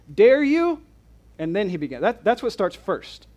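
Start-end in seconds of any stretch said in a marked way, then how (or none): 0.1-0.8 "Dare you?" shot up high and loud in disbelief; incredulous. Shown as a demonstration. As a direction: ask "Dare you?" as if you can't believe it. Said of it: "So what does this already say?".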